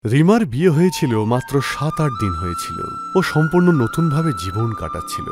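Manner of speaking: ordinary delivery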